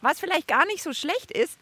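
ordinary talk

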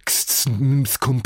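crisp clipped syllables; staccato. A narrator's reading. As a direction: snap out each sound sharply. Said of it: "sollte heißen".

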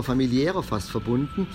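ordinary delivery

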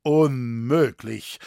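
loud, slow, drawn-out command; ringing word of command. A narrator's reading. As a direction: overrule the din with authority. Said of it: "mit Gebieterstimme den Lärm übertönend".

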